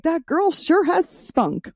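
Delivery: high, drawn-out fake compliment; slow and high, parroting a canned compliment; mock PR pitch. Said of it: "will add a little something like".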